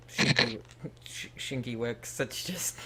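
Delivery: name broken by a giggle; laughing. Real, not acted. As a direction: stumble over it, stifling a laugh. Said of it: "I'm trying not to laugh at that last name".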